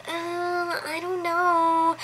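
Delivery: high, drawn-out unsure murmur; confused. Retold or imitated. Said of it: "she's very confused about what she should do".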